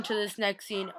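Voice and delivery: usual speaking voice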